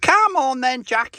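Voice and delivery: high loud call; yelling across the room. Real, not acted. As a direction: hurry her up from afar. Calling Jackie again.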